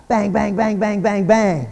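rapid-fire bangs, voiced as gunshots; punchy spoken gun bangs; imitated gunshots. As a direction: mimic six shots ringing out. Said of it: "you hear six shots ring out".

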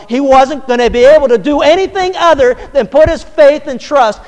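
preaching at full force. Real, not acted. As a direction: thunder it to the congregation. Preaching climax.

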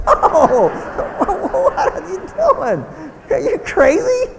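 high laughing no, then slow disbelief; laughing refusal. Retold or imitated. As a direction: laugh off the silly request. Voicing laughing parent.

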